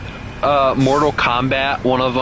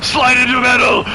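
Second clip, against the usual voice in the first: battle cry; 11 semitones higher, 5 dB louder than usual. Pretend.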